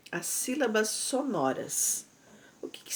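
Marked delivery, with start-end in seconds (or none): 2.6-3.0 whispered question. Shown as a demonstration. Teacher poses question to pupils.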